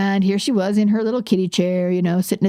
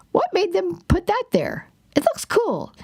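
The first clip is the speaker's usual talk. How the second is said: much higher, light and amused musing; curious inner wonder; 11 semitones higher than usual. Retold or imitated. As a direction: marvel at a plant like a kid.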